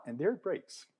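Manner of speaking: ordinary talk, nothing marked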